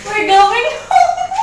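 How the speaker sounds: calling out. Acted. Calling out to chef.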